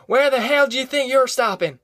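high-pitched, indignant holler from a passing car; irate driver's shout; angry yell. A narrator's reading. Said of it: "leaned out and yelled".

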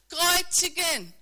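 loud, slow, drawn-out crowd shout; mob yell. Acted. Preacher acting the crowd demanding crucifixion, slow loud yell. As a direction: bellow it like the crowd. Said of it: "noch lauter zu schreien".

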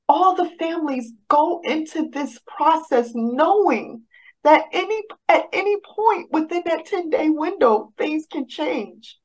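lightly voiced calm counselor reassuring her; soothing advocate. Advocate explains ten-day window.

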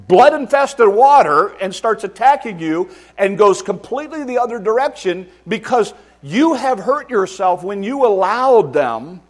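loud, high, driving sermon; preacher warning parents, loud and high; full-force preaching. Acted. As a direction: pound the point home from the pulpit.

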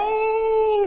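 high, drawn-out mock toddler wail; berro de criança. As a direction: imitate a child suddenly starting to bawl. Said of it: "berrando, não sei o que". (berro agudo imitando choro de birra)

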